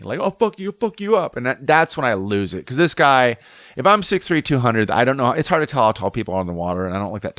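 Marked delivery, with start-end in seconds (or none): 0.2-1.4 high-pitched imitation of an empty threat; retold threat in a raised pitch; mock threat. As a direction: threaten like a kid talking big. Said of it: "trying to threaten me".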